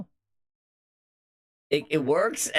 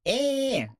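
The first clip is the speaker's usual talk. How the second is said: high pitched voice changer; 12 semitones higher than usual. Real, not acted.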